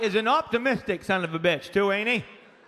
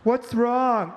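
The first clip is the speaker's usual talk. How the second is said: higher voice of mom asking; worried mother; 3 semitones higher than usual. Retold or imitated. Mom answering the dad, higher voice.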